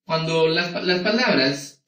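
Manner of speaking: ordinary delivery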